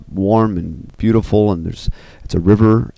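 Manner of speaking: usual speaking voice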